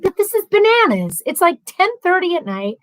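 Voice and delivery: high-pitched mock exasperation; retold indignant protest. Retells protesting to Mike about fireworks.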